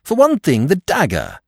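high, loud start dropping away; bursting in. A narrator's reading. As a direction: jump in high, then check yourself. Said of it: "bursting in".

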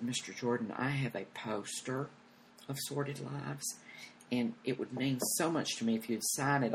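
lightly voiced fan asking softly for a favor; fan's request. Fan's timid request, retold.

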